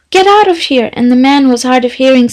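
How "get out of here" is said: angry. A narrator's reading. Angry patient throws visitor out.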